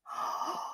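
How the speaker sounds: sharp breathy gasp; shocked gasp. Real, not acted. Vlogger reacting to Dorit blind item with a gasp. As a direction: suck in air in mock shock.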